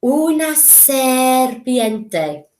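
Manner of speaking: slow, hissing snake delivery; slow serpent voice. Shown as a demonstration. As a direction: stretch the s like a snake. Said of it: "You try it".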